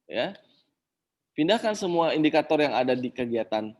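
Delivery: ordinary talk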